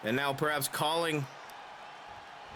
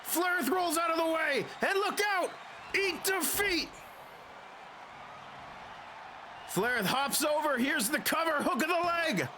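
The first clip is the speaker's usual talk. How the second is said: screaming finish call, much higher; wrestling commentator; 13 semitones higher than usual. Acted. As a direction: shout the big title-win call. Match finish in game commentary.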